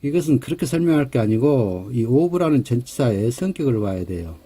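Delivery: normal talking voice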